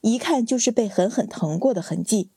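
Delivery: ordinary delivery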